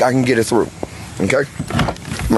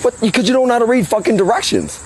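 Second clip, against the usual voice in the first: heated scolding; 9 semitones higher than usual. Real, not acted. Scolding the other roofer.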